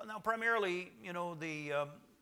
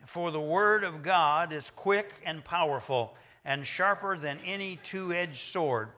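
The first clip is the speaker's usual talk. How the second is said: reading scripture aloud; 5 dB louder than usual. Real, not acted. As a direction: read it out like a congregation reciting. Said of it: "let's go ahead and read it aloud, uh, together".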